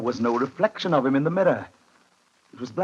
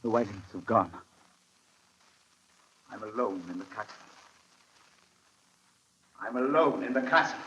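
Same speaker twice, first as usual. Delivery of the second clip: much softer, stunned realization; hushed despair; 3 semitones lower, 7 dB quieter than usual. Acted. Harker realises he is left alone, hushed despair. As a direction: barely voice it, stunned.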